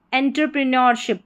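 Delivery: quick, unbroken entrepreneurship; whole word at once. Shown as a demonstration. Teacher giving the whole spelling joined up, fast and unbroken. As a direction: run entrepreneurship together briskly as one word. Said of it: "पूरी spelling".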